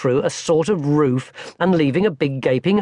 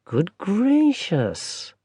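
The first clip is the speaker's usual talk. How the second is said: slow, mild granny exclamation; a gently amused old lady; grandmother exclaiming; 6 semitones higher, much slower than usual. Acted. Grandmother reacts to Barney's friend Stig, slow mild granny exclamation. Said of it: "exclaimed his grandmother".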